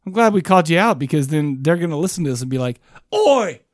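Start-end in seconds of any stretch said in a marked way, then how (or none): 3.1-3.6 high, slow, badly faked Swedish accent; a clumsy try at a Swedish accent. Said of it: "I don't know what accent that was".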